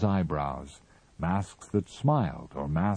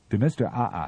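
plain reading voice

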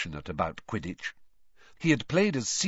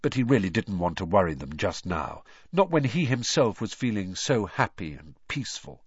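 usual speaking voice

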